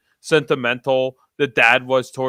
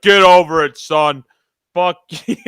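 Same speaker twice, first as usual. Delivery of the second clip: louder, dismissive mock dad with a laugh breaking in; mock cold dad; 2 semitones higher, 9 dB louder than usual. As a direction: bark it out like a callous father, half laughing.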